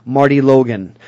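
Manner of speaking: "Marty Logan" with a falling close; downward inflection. Shown as a demonstration. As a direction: drop the pitch through "Logan". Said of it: "It's downward inflection".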